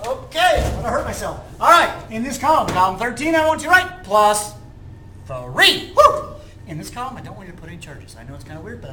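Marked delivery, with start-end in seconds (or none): 0.0-5.9 high, pushy salesman pitch; car salesman. Acted. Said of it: "Do I sound like a car salesman?". 5.9-6.3 high, loud excited whoop. Acted. Excited cheer mid pitch.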